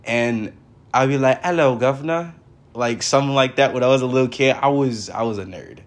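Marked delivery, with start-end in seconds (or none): 1.4-2.3 higher-pitched mock British greeting; British accent. Boy imitating a British voice for his grandma, British accent. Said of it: "like, a British voice".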